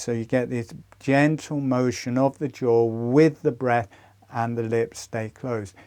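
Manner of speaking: normal talking voice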